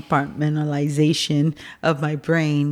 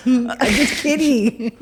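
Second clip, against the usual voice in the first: slow, laughed-out words; laughing; 7 semitones higher, slower than usual. Real, not acted. Mom taking back her teasing of daughter, laughing. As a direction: say it through a real laugh.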